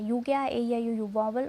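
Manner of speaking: ordinary delivery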